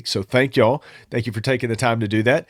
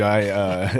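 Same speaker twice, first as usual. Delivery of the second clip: ordinary talk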